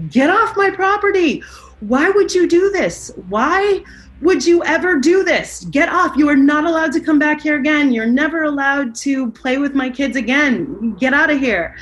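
high, loud imitation of an outraged yell; retold screaming. Mother ordering the boy off her property, retold as high screaming. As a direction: re-enact her yelling, pitched well up. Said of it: "she started screaming".

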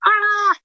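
long high strangled "ah" of overwhelm; slow, high overwhelmed groan; exasperated cry. Retold or imitated. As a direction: let out a drawn-out, frazzled wail. Overwhelmed by crying children.

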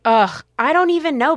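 exasperated groan, then loud and high; fed-up ugh; annoyed ugh. Real, not acted. Exasperated reply to a Lifetime dig.

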